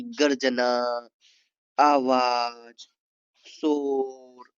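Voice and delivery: slow, drawn-out voiced words; voiced words demo. Shown as a demonstration. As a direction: stretch गर्जना, आवाज, शोर very slowly. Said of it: "इसमें से कुछ words भी आप बोल सकते हैं". (धीमे खींचकर बोले गए शब्द)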